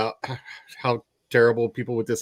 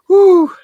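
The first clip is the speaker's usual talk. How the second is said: loud whew; 11 dB louder than usual. Real, not acted. Relieved exhale after rant talk.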